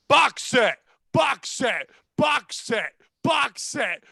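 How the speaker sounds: imitating evenly repeated excited shouts; imitated repetitive yelling. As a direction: yell it over and over like a car alarm. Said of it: "this guy just yelled".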